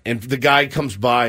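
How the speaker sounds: usual speaking voice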